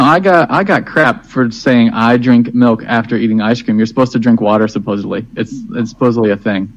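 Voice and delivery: ordinary delivery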